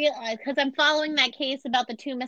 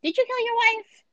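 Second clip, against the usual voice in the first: accusing cop; 11 semitones higher than usual. Retold or imitated. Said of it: "and he's like".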